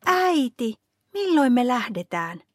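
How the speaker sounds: high-pitched, eager call to mother; excited child's call. A narrator's reading. Niila rushes in to ask mother about leaving, calling out. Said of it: "Niila ryntää sisään ja huutaa".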